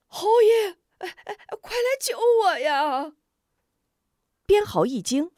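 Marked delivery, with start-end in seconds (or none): 0.1-3.1 high, loud panicked cry for help; shouting for help. Acted. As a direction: yell across the clearing to be saved. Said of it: "大声喊叫".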